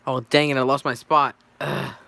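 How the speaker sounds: annoyed outburst at a slip; real exasperation. Real, not acted. Reader loses their spot in the script, exasperated.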